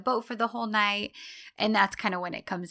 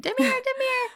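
high calling out a name; mock yelling. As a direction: call him from across the house, mock. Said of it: "She's yelling for".

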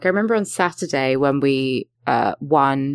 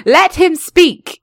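loud, high, drawn-out shout; mock commanding shout; 12 semitones higher, 7 dB louder, much slower than usual. Demanding the co-host be heard, mock shouted order. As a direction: call out slowly over the chatter. Calling out over others for impression.

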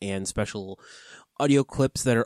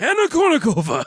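Voldemort voice; 11 semitones higher, 6 dB louder, faster than usual. Acted. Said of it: "The Voldemort voice".

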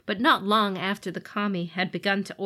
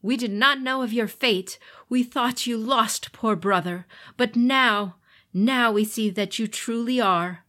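solemn declaration; 4 semitones higher, 5 dB louder than usual. Acted. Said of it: "declared Hante".

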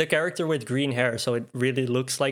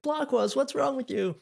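high, put-on voice, pitched way up; game character line; 12 semitones higher than usual. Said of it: "So for me, it was like".

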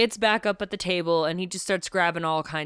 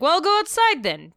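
higher, louder mock-impatient retort; exasperated snap; 10 semitones higher, 6 dB louder than usual. Tybalt snapping at Pate to go outside. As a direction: toss it off, fed up. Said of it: "he's like".

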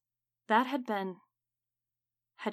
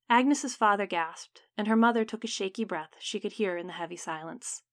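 ordinary delivery

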